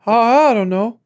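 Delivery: high, loud, stammering mock panic; flustered candidate impression. Mimicking wide-eyed debate candidate.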